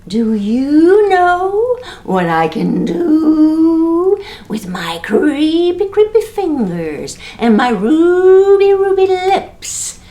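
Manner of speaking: creepy ghost. Acted. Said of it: "the greenish whitish ghost said to the baby".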